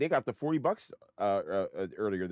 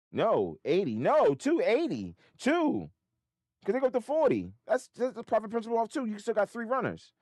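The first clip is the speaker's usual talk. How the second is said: high, loud, agitated outburst; worked-up realization; 9 dB louder than usual. Real, not acted. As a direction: blurt it out loudly, pitch soaring. Realizes missed profit, worked up.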